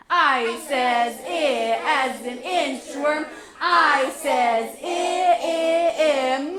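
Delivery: slow, pleading chant; beggar voice. Shown as a demonstration. Teacher chanting the sound like a beggar. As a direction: beg for it slowly. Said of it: "Say it like a beggar".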